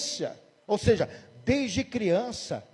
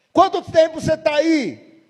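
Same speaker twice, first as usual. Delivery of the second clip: commanding pastor; 7 semitones higher, 7 dB louder, slower than usual. Retold or imitated. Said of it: "o pastor".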